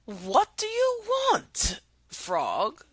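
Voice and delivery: high, slow queen. Acted. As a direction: ask the frog slowly, up high. Queen questions frog.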